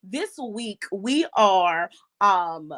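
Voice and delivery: ordinary talk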